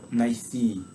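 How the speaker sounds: usual speaking voice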